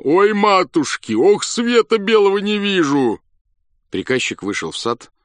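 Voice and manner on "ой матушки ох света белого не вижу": much higher, loud wail of pain; a general howling in pain. A narrator's reading. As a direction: howl out the toothache. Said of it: "вопил он".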